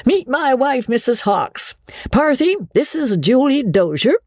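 high, hearty showman's patter; bluff riverboat captain. A narrator's reading. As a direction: introduce them with nervous bravado. Andy voiced, held figure.

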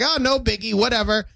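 high airy no biggie; breezy dismissive. Retold or imitated. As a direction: shrug it off lightly. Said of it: "I was like".

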